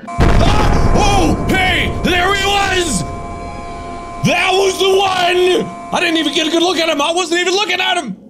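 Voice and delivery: high frantic yelling; game jump-scare panic; panicked shouting. Real, not acted. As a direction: scream it out in panic.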